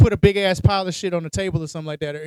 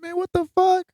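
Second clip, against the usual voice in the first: higher startled outburst; 12 semitones higher than usual. Retold or imitated. Retelling his fright in the haunted game, startled. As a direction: blurt it out shocked. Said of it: "I'm like".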